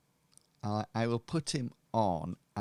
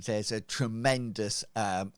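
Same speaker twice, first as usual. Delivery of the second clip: usual speaking voice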